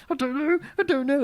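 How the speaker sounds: husband mocking his wife's tense indecision, high and fretful; mock anxious wife. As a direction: imitate her tense dithering. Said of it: "she's like".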